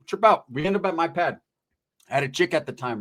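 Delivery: normal talking voice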